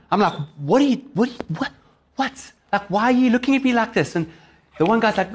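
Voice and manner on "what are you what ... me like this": higher, stammering mock indignation; retold irritation. Driver to staring petrol attendants, irritated. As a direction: act out being bothered by stares. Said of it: "I'm irritated".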